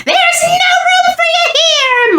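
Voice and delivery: high, bossy little squeak; squeaky mouse voice. A narrator's reading. Mouse evicting the statues, bossy squeak. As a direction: shoo them out in a tiny mouse voice. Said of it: "Mortimer said".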